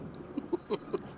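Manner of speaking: quiet breathy chuckle; soft laugh. Acted. Frodo teases Sam about the knot, soft chuckle.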